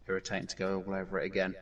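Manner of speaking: ordinary talk, nothing marked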